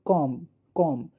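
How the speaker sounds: कॉम said slowly twice, the b not sounded; silent b. Shown as a demonstration. Teacher rereads the word for pupils, slowly and carefully. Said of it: "read it again".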